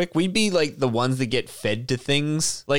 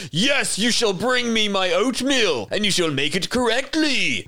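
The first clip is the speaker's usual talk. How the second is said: haughty master; 7 semitones higher than usual. Retold or imitated. Slave owner ordering a servant's oatmeal, haughty. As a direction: order it like a spoilt lord. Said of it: "they'd just be like".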